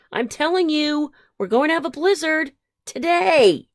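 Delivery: high, loud, emphatic prediction; insistent warning. A narrator's reading. Phyllis warns of a blizzard, urgently insistent. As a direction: warn them, pitch climbing high.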